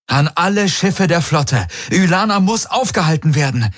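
high, firm commander's address, slowing on the order; fleet-wide broadcast. Acted. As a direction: command every ship to stand down. Broadcast to all ships.

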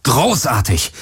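repeated sarcastic call, pitch jumping; exasperated exclamation. A narrator's reading. Pilot as the weapons die, exasperated repeat. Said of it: "rief er".

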